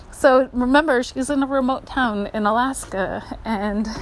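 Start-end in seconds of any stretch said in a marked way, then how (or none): none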